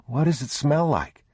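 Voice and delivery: put-on a small boy full of questions; young boy. A narrator's reading. Boy asking his father about the smell. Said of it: "the boy said".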